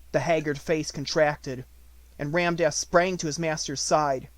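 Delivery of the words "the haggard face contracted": ordinary delivery, nothing marked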